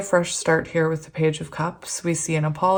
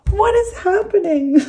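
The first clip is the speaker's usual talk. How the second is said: much higher, louder, real delight; overjoyed, can't believe it; thrilled squeal; 14 semitones higher, 5 dB louder than usual. Real, not acted. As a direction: shriek with joy at the card. Happiest card comes up.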